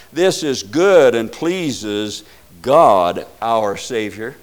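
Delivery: slow, loud, weighty quotation; emphatic scripture reading. Real, not acted. Preacher quotes First Timothy, slow and emphatic. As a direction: spell out the verse slowly and forcefully.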